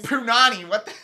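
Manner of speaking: high, loud, shocked punani; shocked echo. Real, not acted. Hearing her friend say punani, shocked high echo. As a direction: repeat the word in disbelief.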